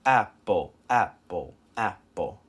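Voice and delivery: APPLE split into two equal, separate syllables; two even beats. Shown as a demonstration. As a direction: say apple evenly, ap-ple, both halves equally long. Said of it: "like that".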